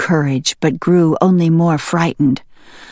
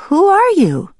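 calling out; 9 semitones higher, 3 dB louder than usual. Acted. Sprout calls to the voice.

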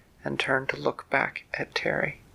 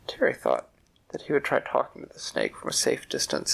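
plain reading voice

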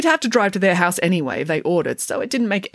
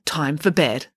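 ordinary delivery

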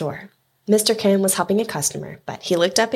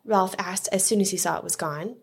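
usual speaking voice

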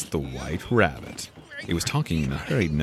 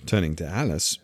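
plain reading voice

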